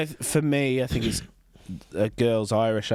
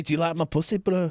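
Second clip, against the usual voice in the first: higher-pitched imitated Hull woman; Hull accent; 5 semitones higher than usual. Coaching co-host in dancer's line, mock Hull. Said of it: "a girl with that accent from Hull".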